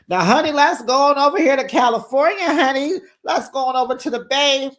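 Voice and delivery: high, loud, fast show-host segue; excited hostess. Real, not acted. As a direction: launch the next segment like a game-show host. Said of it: "hostess moving on".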